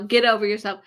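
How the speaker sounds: normal talking voice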